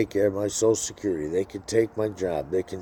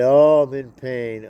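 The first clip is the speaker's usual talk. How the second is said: slow, loud hammed-up whine of pain; mock whiny patient; 5 semitones higher, 8 dB louder, slower than usual. Mocking himself calling the doctor, slow loud whine. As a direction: moan it like a pitiful caller. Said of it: "Nobody likes it when you call in".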